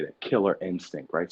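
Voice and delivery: ordinary talk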